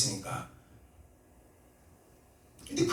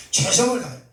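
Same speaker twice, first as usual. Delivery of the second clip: ordinary talk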